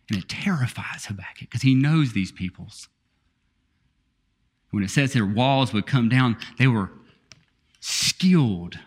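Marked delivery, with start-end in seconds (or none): 0.1-2.9 whisper. Real, not acted. Dramatic aside about terror.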